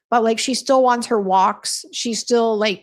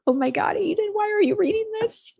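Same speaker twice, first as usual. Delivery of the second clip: pitched way up in embarrassed disbelief; high, mortified oh my God; mortified shriek; 12 semitones higher than usual. Retold or imitated. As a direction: squeal it, horrified he's reading it aloud. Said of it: "I was like".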